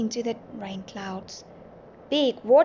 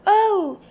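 high, loud cry of pain; high-pitched yelp; pained shout; 10 semitones higher, 11 dB louder than usual. A narrator's reading. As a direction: cry out as if hurt on waking. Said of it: "still shouting".

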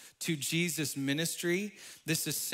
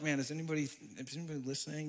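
much softer, low conspiratorial murmur; hushed aside; 4 semitones lower, 8 dB quieter, faster than usual. Retold or imitated. Imagined coffee shop customer, hushed wondering aside. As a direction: drop to a secretive hush.

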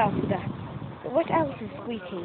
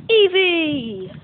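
high, loud creature cry of its name; cute high Eevee cry; Eevee impression; 5 semitones higher, 6 dB louder than usual. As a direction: squeal the name like the Pokemon. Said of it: "my best Eevee impression".

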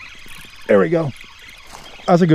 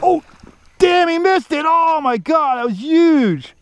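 excited disbelief; 9 semitones higher than usual. Real, not acted. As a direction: voice jumps high in sudden disbelief. Watching a buddy miss fish.

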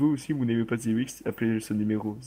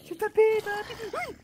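squeaky excited; 3 dB louder than usual. Real, not acted. Excited game reaction.

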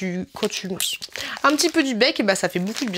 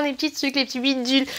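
normal talking voice

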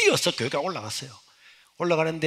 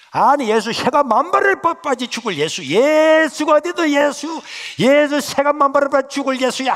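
furious cursing; 10 semitones higher, 8 dB louder than usual. Retold or imitated. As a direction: spit curses at Jesus. Mother curses Jesus. (예수를 저주하는 분노한 어머니)

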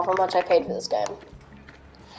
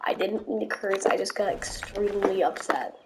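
sheepish apology; 9 dB quieter than usual. Real, not acted. Apology after outburst.

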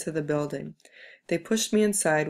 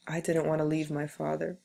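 ordinary delivery

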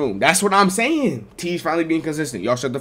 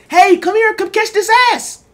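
put-on high loud girlish call; girl's invite; 7 dB louder than usual. Girl calls him over, high loud mock voice. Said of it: "If the hoe says".

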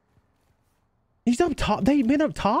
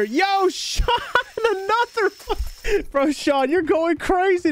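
much higher, laughing shout-out; excited and laughing; 13 semitones higher, slower than usual. Real, not acted. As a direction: hype the generous viewer up. Reacting to a viewer's donation.

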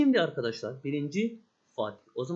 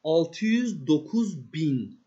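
slow emphatic reading; 2 semitones lower, 3 dB louder, much slower than usual. Shown as a demonstration. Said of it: "Bakın o var zaten".

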